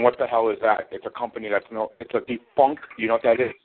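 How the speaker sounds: normal talking voice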